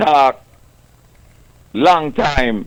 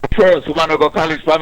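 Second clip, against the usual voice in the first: usual speaking voice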